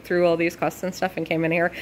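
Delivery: ordinary talk, nothing marked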